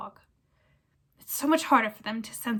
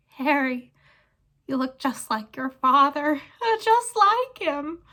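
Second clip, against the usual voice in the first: high, loud wheedling; fawning whine; 5 semitones higher, 5 dB louder, slower than usual. Acted. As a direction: flatter Harry desperately. Pettigrew flatters Harry.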